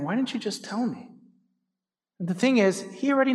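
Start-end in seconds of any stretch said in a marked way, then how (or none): 0.0-1.1 soft, quiet and gentle; a hushed, tender call to come home; tender God's voice. Pretend. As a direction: say it like a loving father holding a child.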